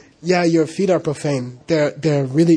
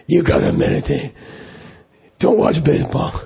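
lightly imitated stern lama giving orders; mean lama voice. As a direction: scold a young monk as the mean lama. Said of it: "His voice was like that".

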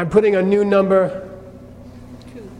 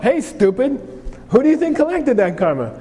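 higher-pitched acted telling-off; mock self-scolding; 6 semitones higher than usual. Teacher acting out a Buddhist telling himself off, mock scolding. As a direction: call yourself out, mock exasperated.

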